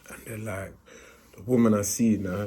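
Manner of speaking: ordinary delivery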